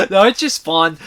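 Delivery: amused, chuckling delivery; laughing. Real, not acted. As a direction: laugh it off as you speak. Laughing after his quoted movie line.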